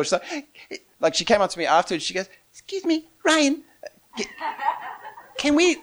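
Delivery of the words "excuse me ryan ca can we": put-on high, small child's voice; cute little girl. Little girl asking Ryan afterwards, high cute voice. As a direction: pipe up politely like a little girl. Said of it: "one little cute little six or seven-year-old girl".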